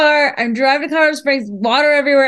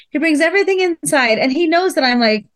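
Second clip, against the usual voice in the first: ordinary delivery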